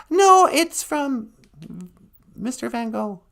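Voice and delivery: perky sidekick announcing a call; chirpy high announcement; high assistant voice. Acted.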